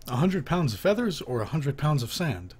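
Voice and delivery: usual speaking voice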